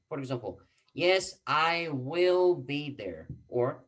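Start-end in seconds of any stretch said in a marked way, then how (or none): 0.9-3.3 slow demo answer. Shown as a demonstration. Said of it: "two different way to answer".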